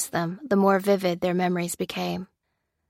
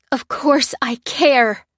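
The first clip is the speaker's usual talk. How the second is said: higher, louder, slow yell; 6 semitones higher, 7 dB louder, slower than usual. A narrator's reading. Lucy yelling back at Lopez, slow loud yell. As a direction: shout it out in anger. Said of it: "yelled Lucy".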